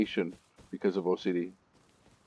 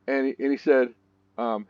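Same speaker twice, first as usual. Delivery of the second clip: usual speaking voice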